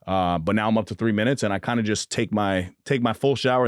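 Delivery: ordinary talk, nothing marked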